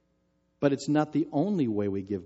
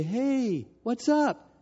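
high, cheery hello to a little one; bright grandpa greeting; 11 semitones higher, much slower than usual. Retold or imitated. Grandpa calling his granddaughter, bright and cheery. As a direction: sound delighted to hear her.

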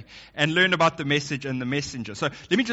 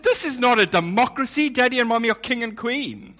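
mock authoritative dad; 6 semitones higher, 4 dB louder than usual. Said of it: "So I said".

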